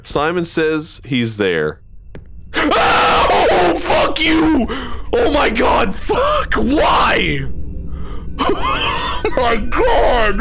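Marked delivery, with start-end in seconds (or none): 2.5-10.4 panicked screaming. Real, not acted. Streamer chased by a monster, shrieking in fright. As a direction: scream and swear in real panic. Jump scare, panicked yelling.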